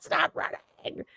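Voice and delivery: breathy whisper, dragged out slowly; whispered plea. Retold or imitated. Friends pleading with her to stop, slow whisper. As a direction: hiss it under your breath, slowly.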